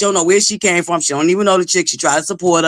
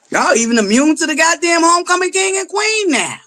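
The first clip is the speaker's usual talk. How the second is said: high shouted complaint; exasperated shout; 7 semitones higher than usual. Real, not acted. Streamer scolds viewers ignoring her greeting, high shout. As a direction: yell it up high, fed up.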